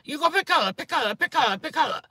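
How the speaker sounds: high, loud mock nail salon lady chanting; imitating nail lady. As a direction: rattle off the pushy manicurist's order. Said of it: "panic attacks".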